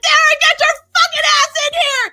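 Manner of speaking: high, loud fierce command; fierce yell. Retold or imitated. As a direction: yell him into the cave. Holly yelling at Derek.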